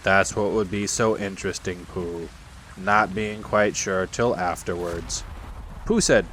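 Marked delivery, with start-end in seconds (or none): 0.0-5.2 low, slow, gloomy donkey; gloomy Eeyore. Acted. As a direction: drone it slowly in a low monotone.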